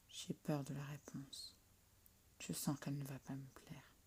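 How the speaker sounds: very soft anxious thought; fearful whisper. Acted. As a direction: whisper your dread.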